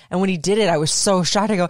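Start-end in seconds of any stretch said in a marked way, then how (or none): none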